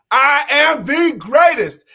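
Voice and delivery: high, loud, slow declaration. Shown as a demonstration. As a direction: say it with your chest. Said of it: "Say it out loud".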